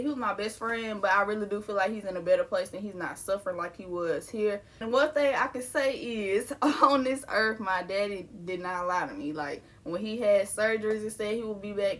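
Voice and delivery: usual speaking voice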